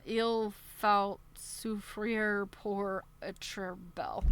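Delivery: slow, careful, unsure French words; halting French attempt. Shown as a demonstration. Streamer stumbling through a French quote for viewers, slow and unsure. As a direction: sound out the French phrase haltingly. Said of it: "I can't even begin with the French".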